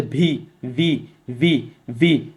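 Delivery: "ভি" repeated separately, teeth on lip; lip-teeth V drill. Shown as a demonstration. Teacher leading students to repeat along, careful V pronunciation. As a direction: buzz the v of "ভি" against upper teeth. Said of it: "স্পর্শ করে উচ্চারণ করতে হয়".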